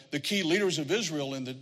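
usual speaking voice

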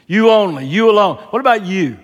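"You" hit loud and high each time; emphatic. Acted. Jesus questioning his disciples, emphatic on "You". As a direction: punch every "You" hard. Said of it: "it's emphatic".

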